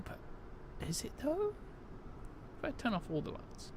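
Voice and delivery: ordinary talk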